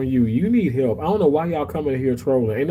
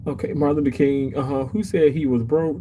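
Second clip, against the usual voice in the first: ordinary delivery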